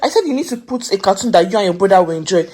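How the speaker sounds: loud firm telling-off; scolding. Real, not acted. Mother scolds daughter over cartoon.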